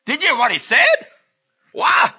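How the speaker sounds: high, loud outburst of astonishment; totally amazed. Acted. Preacher acting out astonished disciples reacting to Jesus, totally amazed. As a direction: blown away, voice leaping up in disbelief. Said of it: "they were totally amazed".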